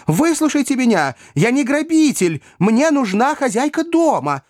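high, urgent plea; desperate pleading. A narrator's reading. Pickwick pleads with the panicking ladies, desperate and high. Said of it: "доведённый до отчаяния".

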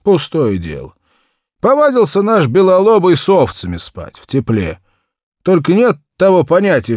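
put-on high, loud, blustering old peasant; gruff peasant Ignat. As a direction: brush it off loudly like a grumbling farmer. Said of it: "ответил Игнат".

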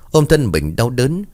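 usual speaking voice

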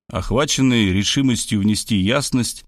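plain reading voice

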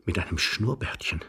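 hushed, low, appalled murmur; soft and low, aghast at the realisation; horrified whisper. A narrator's reading. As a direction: murmur it in dawning horror. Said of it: "flüsterte Iwan Andrejewitsch entsetzt".

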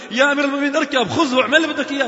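high, loud, hurried urging of the commander; flustered loud plea. Acted. Slave boy pleading loudly with the caliph to ride. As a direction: push him to take the donkey, flustered.

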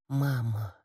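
very soft, faint call for mother; barely audible. A narrator's reading. Dying fighter calls for his mother, barely audible. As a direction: say it with the lips alone, hardly any voice. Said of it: "Тихо-тихо, едва слышно, одними губами".

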